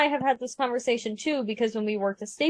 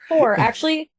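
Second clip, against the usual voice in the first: ordinary talk, nothing marked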